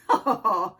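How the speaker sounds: low, loud hearty laugh; a big low belly laugh. Acted. Nutcracker laughs at Oscar's question, loud low laugh. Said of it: "The Nutcracker laughed".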